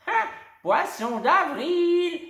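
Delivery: very high, loud and slow; springing the prank back; triumphant exclaim. A narrator's reading. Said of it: "s'exclame alors la dame". (exclamation triomphante, lente)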